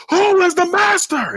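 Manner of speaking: high, slow mock holler; hammed-up movie villain challenge; villain's demand. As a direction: stretch it out high, demanding submission. Retold villain demanding submission.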